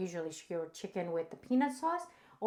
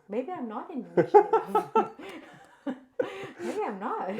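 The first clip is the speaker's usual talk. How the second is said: higher, giggly mock doubt; laughing self-mockery; 5 semitones higher than usual. Real, not acted. Streamer teased about being Indonesian, laughing self-mockery. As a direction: doubt yourself through laughter.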